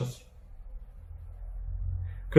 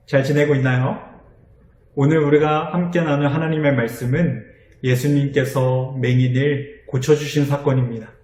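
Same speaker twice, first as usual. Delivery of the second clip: normal talking voice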